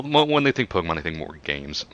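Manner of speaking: ordinary talk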